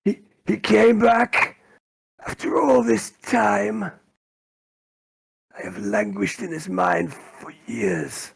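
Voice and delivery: high, slow wretched mutant, overcome; Rufus. Acted. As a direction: cry it out slowly, high and loud, after years of languishing. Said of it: "He goes".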